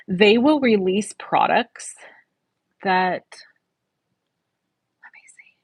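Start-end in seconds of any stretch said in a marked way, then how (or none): 5.0-5.6 whispering. Real, not acted. Checking if product may be shown.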